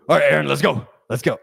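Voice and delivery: brisk, loud boss voice; loud calling. Retold or imitated. Boss leaving the office to trainee, loud and brisk. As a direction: call out loudly to round up your trainee.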